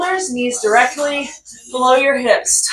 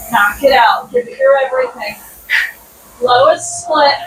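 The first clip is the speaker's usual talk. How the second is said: usual speaking voice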